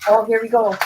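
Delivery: normal talking voice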